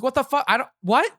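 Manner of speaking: a sudden high-pitched what-the-fuck; jolted into baffled disbelief; shocked disbelief. Retold or imitated. Retelling his reaction to a grim question, shocked and baffled. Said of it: "I'm like".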